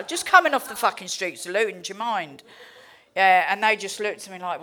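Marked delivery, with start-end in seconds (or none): none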